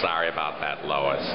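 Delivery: low, soft, lightly voiced Cleveland; a hint of Cleveland's mellow voice; Cleveland impression. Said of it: "Or maybe doing Cleveland".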